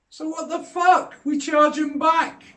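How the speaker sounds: high, slow, exasperated challenge; indignant outburst. Real, not acted. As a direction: throw it back at them, fed up. Ranting about EU tariffs.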